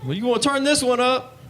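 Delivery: high-pitched rapper hype voice. Acted. Rapper talking to the stoned listener from the song, high mock hype. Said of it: "rappers always announce themselves".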